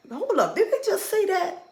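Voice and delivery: high-pitched mock disbelief; can't believe what was just said; incredulous reaction. Imagined reaction to an offensive remark.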